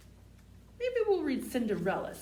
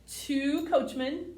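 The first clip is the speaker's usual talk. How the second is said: slow, drawn-out counting-book read; slow bedtime read-aloud; reading to children; slower than usual. Acted. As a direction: savour each number for a little listener.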